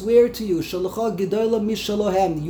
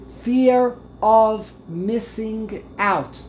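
slow and deliberate; much slower than usual. Shown as a demonstration. Spelling out the acronym slowly.

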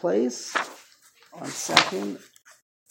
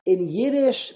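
ordinary delivery

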